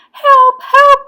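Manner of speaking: high, loud cries; urgent shout down the phone; panicked call for help. A narrator's reading. Boy phoning the policeman for help, panicked shout.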